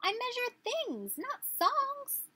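very high, slow tiny bug voice; protesting inchworm. Acted. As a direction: object in a squeaky little voice. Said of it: "said the inchworm".

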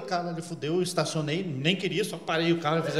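whisper-quiet panic as the cop stops him; scared hush. Retold or imitated. Medo ao ser parado. (bem baixo, com medo)

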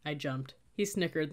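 usual speaking voice

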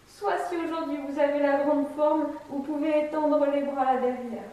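ordinary delivery

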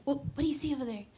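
quick playful parent voice; talking to kids. Retold or imitated. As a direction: coax a toddler to look. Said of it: "the same way that you talk to them".

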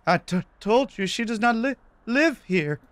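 high, stammering falsetto; nervous woman. Acted. Nervous woman turning away the visitor, high stammering falsetto. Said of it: "whoever it was sounded nervous".

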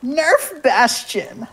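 put-on breathy cutesy high girly voice; very high, slow, sweet and airy; e-girl voice. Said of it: "more effeminate than I thought you could pull off".

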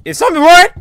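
high, loud panicked question; frightened, shooting up in pitch; panicking. Real, not acted. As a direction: yelp it in alarm. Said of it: "I'm panicking".

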